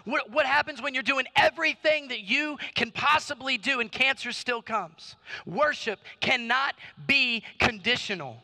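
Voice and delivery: forceful, rhythmic sermon delivery; impassioned preaching. Acted. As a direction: build rhetorical questions with rising intensity.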